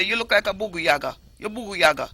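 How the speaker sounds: bugu yaga said in a Jamaican Patois lilt. Shown as a demonstration. Teaching Patois insults to the host, Jamaican accent. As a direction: tease someone as bugu yaga, Jamaican style. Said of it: "if I say".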